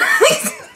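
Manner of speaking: sudden high laugh; real laugh. Real, not acted. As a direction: burst out laughing. Laughs at the show.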